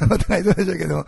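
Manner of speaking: laughing as he repeats お互いそうでしょうけども; chuckling through お互いそうでしょうけども, high and bright; laughing aside. Real, not acted. Pastor teasing fellow pastors, laughing. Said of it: "お互いそうでしょうけどもね".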